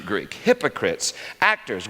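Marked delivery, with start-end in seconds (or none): none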